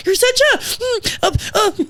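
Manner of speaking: grandma fumbling for an insult she won't say; sputtering grandma. Retold or imitated. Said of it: "my grandma used to be like".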